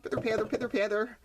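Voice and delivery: quick rain patter; pitter-patter sound. Shown as a demonstration. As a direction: patter it out fast. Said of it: "You can make a pitter-patter sound".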